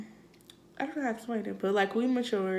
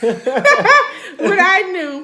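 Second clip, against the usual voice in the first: laughing through words; giggling as she answers; 5 semitones higher, 12 dB louder, much slower than usual. Real, not acted.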